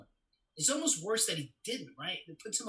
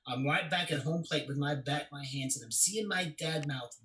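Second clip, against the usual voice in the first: normal talking voice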